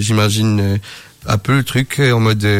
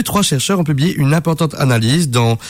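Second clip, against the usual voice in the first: normal talking voice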